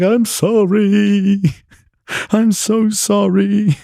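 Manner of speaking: high, loud, drawn-out fake crying; hammed-up tearful squeal, words stretched long; mock wailing. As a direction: wail the apology like a bawling kid. Retold tearful apology as a child.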